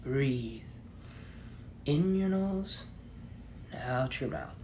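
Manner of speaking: very soft, low, slow app guide; guided meditation voice. Acted. Meditation app guiding the listener to relax and breathe, hushed and slow. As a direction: talk softly right into the ear, easing them down. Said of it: "someone in your ear telling you".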